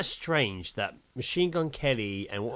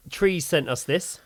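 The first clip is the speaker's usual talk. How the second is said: normal talking voice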